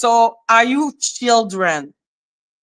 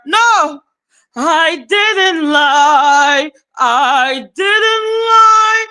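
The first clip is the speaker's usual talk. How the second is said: high, slow, drawn-out imitation of denying; mock whiny denial; 6 semitones higher, 3 dB louder, much slower than usual. Streamer mimicking a woman who won't admit lying, mock wailing. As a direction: wail it in exaggerated mock protest.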